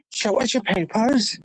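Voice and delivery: high, laughing mock demand of an official; lightly mock a border guard asking for papers; a hint of a sneering official voice. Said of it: "You're going".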